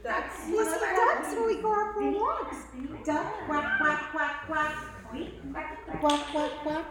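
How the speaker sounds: put-on bright sing-song for a baby; animated baby talk; reading to a toddler. Teaching toddler animal words.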